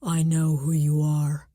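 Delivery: deep voice through a distorting device; low, muffled and menacing over the phone; muffled caller voice. Acted. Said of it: "Then a deep, muffled voice".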